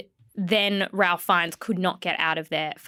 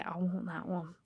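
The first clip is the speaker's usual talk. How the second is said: breathy longing; 4 semitones lower, 14 dB quieter than usual. Shown as a demonstration. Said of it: "We say".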